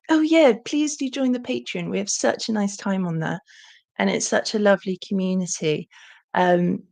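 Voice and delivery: normal talking voice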